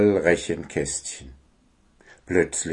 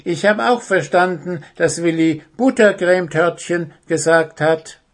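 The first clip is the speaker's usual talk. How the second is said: little hare; 8 semitones higher, 4 dB louder than usual. Acted. The hare continues.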